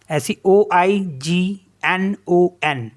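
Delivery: ordinary talk, nothing marked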